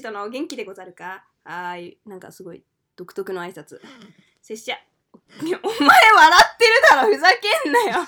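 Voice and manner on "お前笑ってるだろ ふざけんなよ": loud mock anger; loud playful yell. Real, not acted. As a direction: shout it, half laughing. Accusing partner of laughing.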